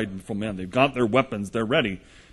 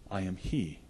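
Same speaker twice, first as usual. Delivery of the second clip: very calm, much softer and lower; 3 semitones lower, 9 dB quieter than usual. Acted. Jesus answering the armed crowd, calm and soft. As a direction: say it quietly, utterly composed. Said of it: "Jesus turns to them very calmly".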